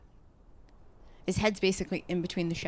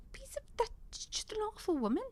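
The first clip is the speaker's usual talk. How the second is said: much higher, voiceless whispered jab; whispered insult; 13 semitones higher, 5 dB quieter than usual. Real, not acted. Whispering an insult about the rude customer. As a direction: hiss it under the breath.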